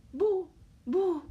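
loud woof woof; dog barking. Acted. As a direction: bark like a small dog.

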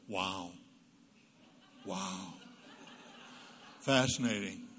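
deadpan wow. Shown as a demonstration. Wife answering husband's dumb remark, deadpan. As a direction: meet the nonsense with bored fascination. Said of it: "just simply look at your husband and go".

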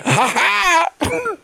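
high triumphant laugh; gleeful cackle. Retold or imitated. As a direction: gloat after the kill. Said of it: "he's like".

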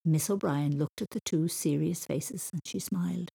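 usual speaking voice

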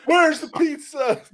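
high, slow, hammed-up demand; mock demanding gunman. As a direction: ham up the angry guy at the door.

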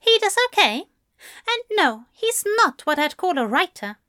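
high-pitched, prim dismissive author; Aziraphale, dismissive. Acted. Aziraphale dismissing his husband's writing.

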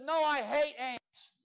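put-on a boy pouting no; boy refusing. Boy tells his mama he hates the ants, slow and pouting.

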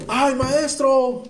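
high, drawn-out wail to the master; high-pitched hammed-up call for help; mock cry out. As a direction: cry out in alarm, stretching the words. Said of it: "Gritó".

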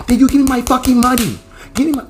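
high, loud imitation of a woman screaming for her money; furious high yell. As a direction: scream the demand at him. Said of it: "She's like".

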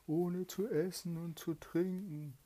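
high, loud eerie take of zu essen und zu trinken; spirit voice. Acted. Trapped spirit complains of hunger, high eerie voice.

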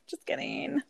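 unvoiced little whisper; soft whispered tease; whispered aside. Real, not acted. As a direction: breathe it as a joke. Whispered joke after laugh.

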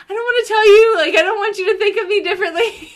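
high-pitched, pleading retold refusal; embarrassed plea. Woman retelling her confession to boyfriend, embarrassed and pleading.

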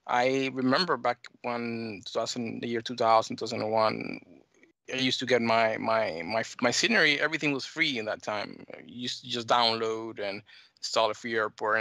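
normal talking voice

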